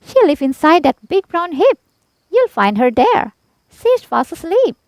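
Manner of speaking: very high, loud hen chirp; chirpy hen. A narrator's reading. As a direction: chip in brightly. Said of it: "hen still speaking".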